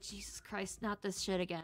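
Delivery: hushed, exasperated quote; weary retold reaction. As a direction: grumble it quietly to yourself. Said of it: "and I was like".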